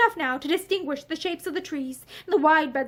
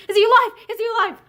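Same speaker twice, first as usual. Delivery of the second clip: high, loud frantic question; desperate fear; 7 semitones higher, 7 dB louder, much faster than usual. A narrator's reading. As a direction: beg to know, frantic. Mother desperate for her son.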